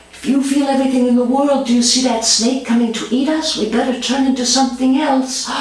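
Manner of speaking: put-on a kid spinning a make-believe danger; lightly voiced child. Child inventing a game.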